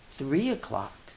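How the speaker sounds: plain reading voice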